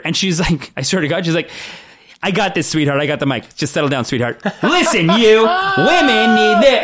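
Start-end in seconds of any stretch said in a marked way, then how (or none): 2.2-6.8 pushy lady. Retold or imitated. Pushy lady voiced, laughing.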